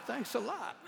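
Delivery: laughing wryly at being left behind; mock thanks through a chuckle; sarcastic laugh. Pastor laughing as his friends walk off.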